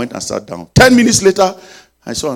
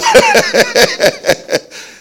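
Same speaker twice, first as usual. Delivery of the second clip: laugh; 8 dB louder than usual. Real, not acted. Preacher laughs after hallelujah.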